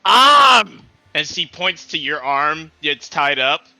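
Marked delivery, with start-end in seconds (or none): 0.1-0.8 high, loud one-word demand; insistent shout. Acted. Fighter repeats demand, calling out.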